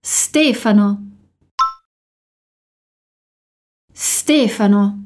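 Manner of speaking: "Stefano" said slowly for repeating; slow, clear model of "Stefano"; a teacher slowing "Stefano" down to repeat; slow model word. Shown as a demonstration. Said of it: "Agora, repete comigo".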